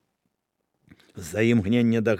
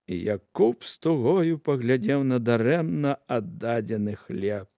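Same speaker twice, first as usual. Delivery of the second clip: usual speaking voice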